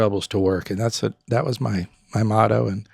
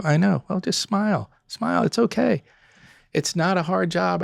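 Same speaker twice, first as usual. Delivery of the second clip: high, soft coaxing encouragement; coaxing smile; 6 semitones higher, 3 dB quieter than usual. Retold or imitated. Owner urges a server to just smile, soft high coaxing. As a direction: gently coax them into smiling.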